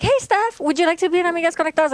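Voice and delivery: high, quick, chirpy imitation; perky retold invitation; imitating friend's invitation. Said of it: "when she's like".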